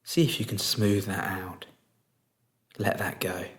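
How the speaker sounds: soft, unhurried meditation voice with long pauses; guided meditation. Real, not acted. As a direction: guide them gently into stillness, leaving space between phrases.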